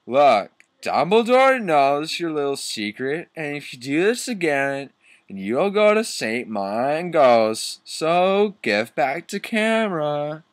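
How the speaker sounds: hammed-up warning, pitched high; threatening student. Student demanding the camera back from the teacher, hammed-up threat.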